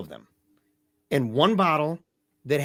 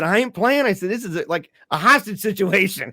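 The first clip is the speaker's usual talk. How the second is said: raised, higher-pitched confrontational retelling; acted defiance; 6 semitones higher, 5 dB louder than usual. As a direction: act out standing your ground, loud and high. Acted standoff with hospital staff.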